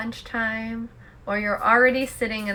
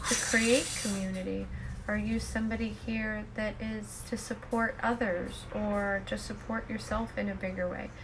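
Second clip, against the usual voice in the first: soft, unhurried meditation voice; guided meditation; 10 dB quieter than usual. Acted. Host asking listeners to look within for peace, soft guided meditation. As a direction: quietly pose each question and let it settle.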